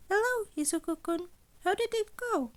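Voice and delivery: playful, teasing, eager to hear; high chirpy principal. Acted. Nezu on the phone.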